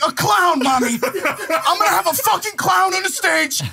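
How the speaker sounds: sarcastic shout. Retold or imitated. Son mocking his mom's question about his show, sarcastic shout. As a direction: throw sarcasm at her, shouting.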